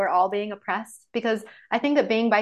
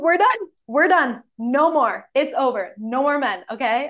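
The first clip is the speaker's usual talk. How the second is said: louder, higher, decisive mock declaration; firm friend; 3 semitones higher, 5 dB louder than usual. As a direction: lay down the law for a friend. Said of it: "she's like".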